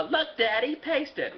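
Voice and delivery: put-on high little-girl plea; a small child holding up her flower; lightly voiced little girl. Sick little Zuzu asks Daddy to fix her flower, high child voice.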